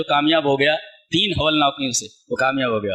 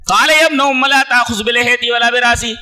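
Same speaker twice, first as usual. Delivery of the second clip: raised, louder chanted Arabic verse; Quran recitation; 7 semitones higher, 5 dB louder than usual. Acted. Preacher reciting the verse to the congregation, chanted. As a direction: intone the verse with fervour.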